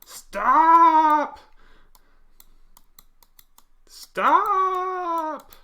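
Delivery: very high, loud screaming stop; desperate yell. Retold or imitated. Hero yelling at a car to make it stop. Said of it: "They go".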